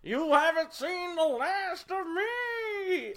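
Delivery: high, slow cackling cartoon villain; hammy evil villain taunt; Skeletor villain voice. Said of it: "That is the farthest thing from a Loki voice".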